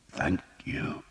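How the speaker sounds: faint hoarse whisper of a weak patient. A narrator's reading. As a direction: barely breathe the thanks. Weak wounded boy whispering thanks.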